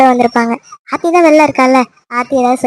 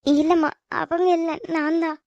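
much softer, slow; meek confession; 11 dB quieter than usual. Acted. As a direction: own up quietly. Daughter takes the blame.

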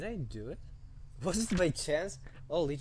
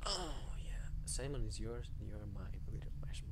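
creepy whisper; 3 semitones lower, 10 dB quieter than usual. Acted. Voicing creepy villain lines softly.

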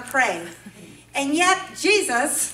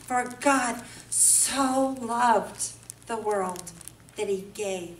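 very slow, softer, lower; reverent recital; 5 semitones lower, 6 dB quieter, much slower than usual. Acted. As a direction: savour every word reverently. Reverent quoting of scripture.

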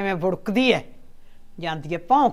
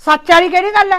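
indignant denial; 7 semitones higher, 7 dB louder than usual. Acted. Mother denies accusation.

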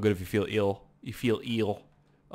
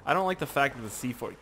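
usual speaking voice

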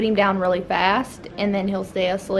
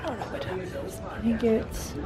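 hushed talk; 7 dB quieter than usual. Real, not acted. Mom quietly updating viewers in the evening, hushed.

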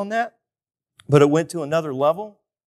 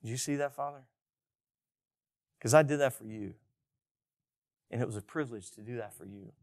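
soft, hushed offering to the Father; humble prayer; 3 semitones lower, 9 dB quieter than usual. Retold or imitated. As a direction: say it quietly, as a gift to God. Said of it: "you just hit the brakes and you go".

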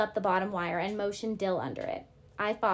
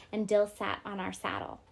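plain reading voice

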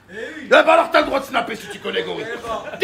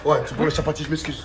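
low, very soft apology; grudging apology; 10 semitones lower, 6 dB quieter than usual. Real, not acted. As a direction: mumble a reluctant sorry. Made to apologise for the chapati.

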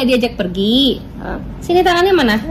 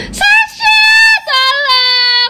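mock cries for help, high and pleading; hammed-up damsel in distress, much higher; pretend distress; 9 semitones higher, slower than usual. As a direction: ham up being attacked, calling the bird. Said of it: "Coba gue pura-pura teraniaya ya".

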